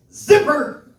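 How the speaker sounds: high, loud mock outburst; exclaimed swear word. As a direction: blurt it out like a shocking curse. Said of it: "I meant to say".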